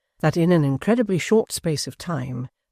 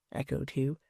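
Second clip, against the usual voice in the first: plain reading voice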